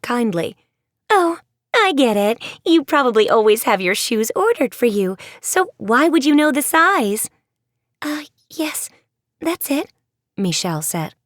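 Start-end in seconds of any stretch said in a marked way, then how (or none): 1.1-7.3 higher, louder eager voice; bubbling over with an idea; eager girl. Acted. Ashlyn to the girls, eager and bright. 8.0-9.9 hesitant, soft, higher agreement; nervous cover. Acted. Michelle going along with Ashlyn's guess, nervously.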